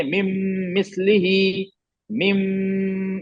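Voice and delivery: "मिम" held as a long nasal m; ghunna. Shown as a demonstration. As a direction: hum on the m of "मिम" before "मिस्लिही". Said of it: "इसमें गुन्ना".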